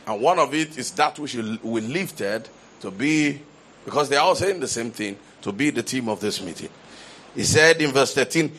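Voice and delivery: ordinary talk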